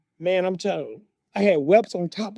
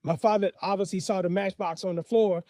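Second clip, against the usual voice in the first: ordinary delivery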